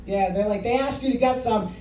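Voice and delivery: ordinary delivery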